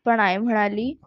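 ordinary delivery, nothing marked

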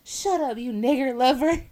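high, loud retold yell; drunk's yell. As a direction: yell it at the man defending her. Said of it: "He was like".

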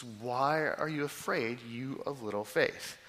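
slowly and deliberately; a slow measured read of scripture; slow, weighty reading. Shown as a demonstration. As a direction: lean into each word slowly. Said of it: "how we should not hear this".

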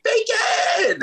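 high delighted scream of the word; screaming bacon. Retold or imitated. Friend imitating [name]'s delighted bacon scream. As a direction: shriek it with joy. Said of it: "She's like".

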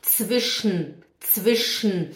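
Zwischen as "tsvish-nn", E swallowed; syllabic N. Shown as a demonstration. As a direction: land Zwischen on a bare hummed n. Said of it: "como é que fala o n?".

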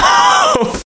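shrieking laugh; high squeal of laughter. Real, not acted. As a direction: let out a squeal. Laughter burst.

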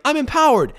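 high, loud mock declaration; mock proud woman. Mocking the woman.

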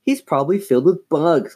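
put-on grossed-out girl; disgusted. A narrator's reading. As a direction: sound revolted by it. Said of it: "making a disgusted face".

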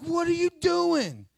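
high, slow, stunned question; shocked what are you doing. Retold or imitated. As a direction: recoil in disbelief at what you see. Reacting to someone beating their child.

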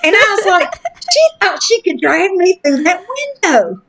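talking through laughter, pitch high; laughing. Real, not acted. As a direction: giggle through the words. Amused by own kidnapping story.

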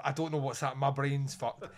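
ordinary talk, nothing marked

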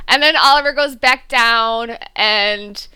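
usual speaking voice

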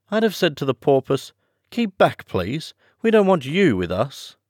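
high, insistent Alice; high-pitched heroine, firm. Acted. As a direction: shoo someone off in a high voice.

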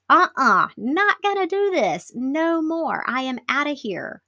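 high, sing-out mock refusal; playful imitation of digging in, pitched high; defiant refusal. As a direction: act out someone firmly done with it. Coworker's body refusing, acted out.

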